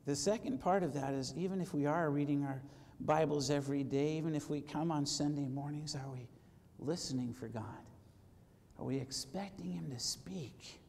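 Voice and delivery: low, soft, intimate preaching; hushed. Real, not acted. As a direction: draw them in, nearly whispered at the end.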